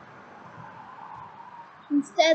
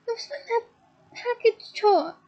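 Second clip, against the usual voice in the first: much higher, tearful sobbed words; 8 semitones higher than usual. A narrator's reading. Leanne answering Hermione through tears, sobbing. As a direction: choke it out between sobs. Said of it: "sobbed Leanne".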